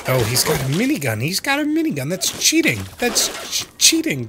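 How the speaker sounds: high panicked protest; alarmed outrage. Real, not acted. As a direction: cry foul at the minigun. Enemy with minigun appears.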